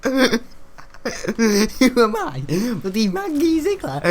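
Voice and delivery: high squeaky mock indignation; mock outraged squeal. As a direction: shriek it like a put-upon character. Continues high put-on voice.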